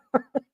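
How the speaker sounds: quick high laugh; a real little laugh; short laugh. Real, not acted. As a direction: burst out laughing. Laughs at own claim.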